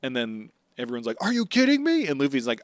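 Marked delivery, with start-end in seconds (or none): 1.2-2.1 high-pitched comic disbelief; mock incredulous outcry. Everyone hearing Luffy is bait, high mock outcry. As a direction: cry out in exaggerated disbelief.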